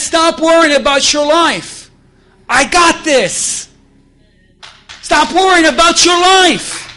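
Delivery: loud, high, slow on I got this; forceful preaching. Acted. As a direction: command them to stop worrying. God's voice, preaching full force.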